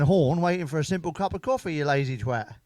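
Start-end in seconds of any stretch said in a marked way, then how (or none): none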